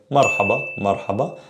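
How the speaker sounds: "marhaba" with a quick, single tapped R; soft R. Shown as a demonstration. Arabic teacher demonstrates soft R, quick single tap. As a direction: say "marhaba" with a light tongue tap. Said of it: "soft R is like saying".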